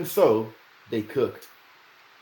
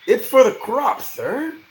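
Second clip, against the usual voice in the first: pleading; 8 dB louder, slower than usual. A narrator's reading. Digger pleading.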